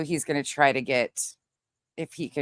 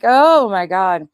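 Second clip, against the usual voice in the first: loud, drawn-out mock dismay; exasperated outburst; 3 semitones higher, 9 dB louder, slower than usual. Woman losing it over partner near elk, loud exasperation. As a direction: wail it slowly in retold disbelief.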